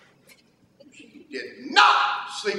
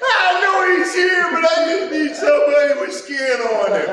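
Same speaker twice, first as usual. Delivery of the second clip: put-on much higher and louder, proclaiming the child's words; preached shout; 10 semitones higher, 8 dB louder than usual. Preacher proclaiming the daughter's words to the church, shouted. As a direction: shout it to the congregation as the punchline.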